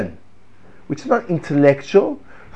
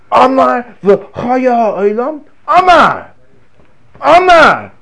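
high, loud, drawn-out call; passionate declaring; 8 semitones higher, 5 dB louder, much slower than usual. Shown as a demonstration. Rabbi showing students the tone of voice, high and loud. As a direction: proclaim it in awe. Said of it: "Notice the tone of voice".

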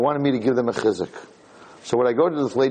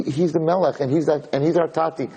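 normal talking voice

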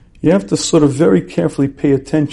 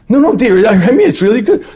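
acted husband, pitched high; mock bickering, higher-pitched denial; flustered protest; 7 semitones higher, 4 dB louder than usual. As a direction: insist over the accusation. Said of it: "have a big fight".

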